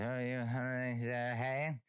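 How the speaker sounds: garbled mock Cajun greeting; slurred Cajun-ish chatter, words run together; mumbled Cajun drawl. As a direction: imitate an unclear Cajun driver. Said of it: "So he's talking like".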